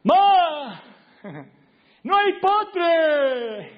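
put-on high, loud whiny teenage call to mom; teen whining. Teenage son calling to his mom from the fridge, whining loud. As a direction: drag out the complaint like a spoiled teen. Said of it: "Abría la heladera y decía".

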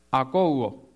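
"Akouo", carefully sounded out with the accent on the O; acento on the O. Shown as a demonstration. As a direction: stress the O in "Akouo". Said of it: "con acento en la O". ("Akouo" con acento en la O)

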